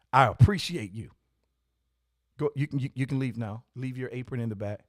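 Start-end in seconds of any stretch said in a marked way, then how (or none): none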